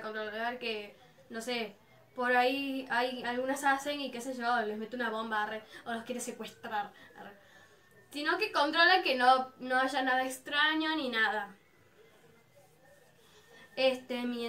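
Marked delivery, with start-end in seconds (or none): none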